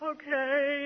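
soft, slow meek agreement; meek soft okay to sister-in-law; meek reply. Retold or imitated. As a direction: give in quietly. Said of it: "I was like".

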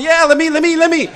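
high, itching Rambo begging; eager Rambo. Acted. Rambo begging to kill, high eager voice. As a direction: beg to be let loose. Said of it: "Rambo's saying over there going".

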